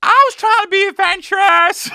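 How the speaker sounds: a high squeal of an eager baker; Hermione impression. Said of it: "she's like".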